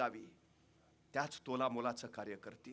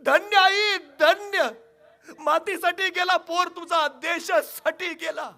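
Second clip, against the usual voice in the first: loud, much higher, slowing reverent praise; awed king; 13 semitones higher, 13 dB louder than usual. Acted. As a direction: hail the mother in ringing awe. (भारावलेल्या राजाचा मोठा गौरवपूर्ण आवाज)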